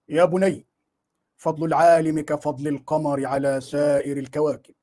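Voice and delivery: put-on slow, solemn old-scholar voice; Ibn Taymiyya voice. Preacher mimics the sheikh advising the dreamer, slow and solemn. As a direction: intone it like a revered sheikh giving counsel. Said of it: "طب أنت بتقلد".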